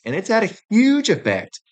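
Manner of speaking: rising rant energy; worked up. Real, not acted. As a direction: stress the huge effect, getting heated. Ranting about a huge effect.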